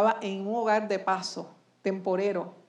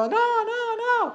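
very high, drawn-out mock protest; imitated protesting crowd; 13 semitones higher, much slower than usual. Crowd wanting action objects to talking, imitated protest. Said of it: "Y todo el mundo".

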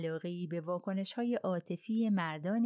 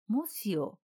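short, slightly raised protest; 3 semitones higher than usual. A narrator's reading. Mr Bouc objects to the threat, protesting. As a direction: object in one word. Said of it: "آقای بوس در اعتراض گفت".

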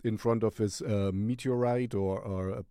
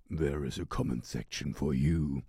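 dramatic sign-off; 8 semitones lower, 3 dB quieter than usual. Acted. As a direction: sign off low and deliberate. Low, slow sign-off to viewers.

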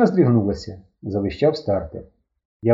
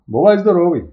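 loud farewell; 6 semitones higher, 6 dB louder than usual. Acted. Guitarist calls goodbye loudly.

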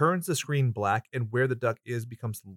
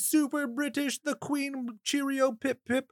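mock British accent; 12 semitones higher than usual. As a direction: ham up a posh British accent. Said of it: "she tries to do Southern".

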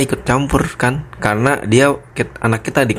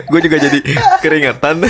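laughing; 6 semitones higher than usual. Real, not acted. Laughing while admitting sweating.

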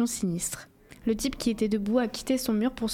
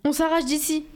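put-on girl shouting at her friend to run; 5 semitones higher than usual. A narrator's reading. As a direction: yell it to break free. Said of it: "Je crie".

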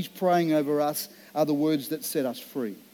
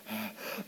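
sigh; 6 semitones lower, 15 dB quieter than usual. Retold or imitated. Retelling heart episode at communion.

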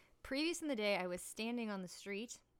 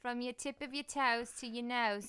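loud, brisk-mannered Cockney meditation guide; hammed-up East End Cockney; Cockney accent; 4 semitones higher, 6 dB louder than usual. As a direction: give calming instructions in unsoothing mock Cockney. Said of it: "like, a, a Cagney accent".

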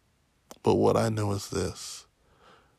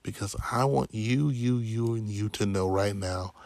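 ordinary delivery

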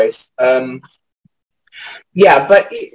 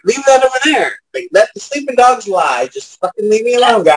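higher, pleading leave-me-alone voice; exasperated brush-off; 7 semitones higher than usual. Retold or imitated. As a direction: wave them all off, fed up. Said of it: "So I'm just kinda like".

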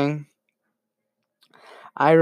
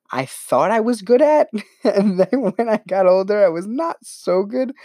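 higher-pitched, laughing admission; amused, laughing; 6 semitones higher than usual. Real, not acted. Vlogger confessing she was not good at rhyming, amused. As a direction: admit it through a laugh.

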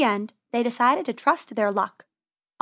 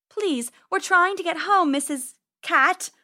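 pleading; 7 semitones higher than usual. Acted. Penny pleads with cat.

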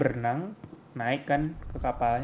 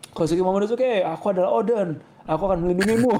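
heroic character voice; 5 semitones higher, 3 dB louder than usual. Retold or imitated. Voicing Yamato as Oden.

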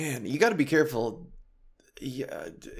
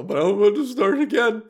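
higher and louder as tears well up; voice breaking; 8 semitones higher, 5 dB louder than usual. Real, not acted. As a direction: fight back the tears. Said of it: "fresh out of a cry".

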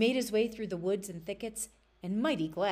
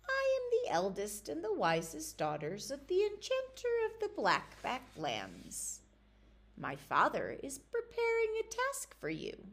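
high, eerie enchanter's daughter; sly high voice of a young witch; 7 semitones higher than usual. Acted. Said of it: "she said".

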